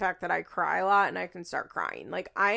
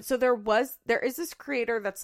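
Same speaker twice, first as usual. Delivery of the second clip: ordinary talk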